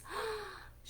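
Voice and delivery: sharp high mock gasp. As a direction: gasp as if shocked for the kids. Chair breaks in the story.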